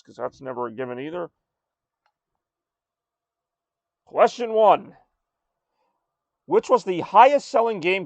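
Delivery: game show host. Acted. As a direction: present it like a quiz show host. Hosts trivia game show.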